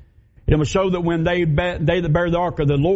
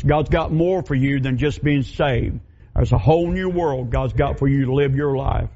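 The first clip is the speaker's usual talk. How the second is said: normal talking voice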